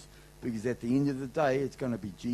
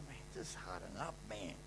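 low, much softer, under-the-breath grumble; muttered tough love; 4 semitones lower, 13 dB quieter than usual. Retold or imitated. Father to his daughter about her scratched toe, muttered. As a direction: mutter it, not for her to hear. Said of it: "I feel like saying".

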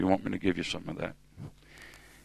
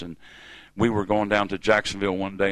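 normal talking voice

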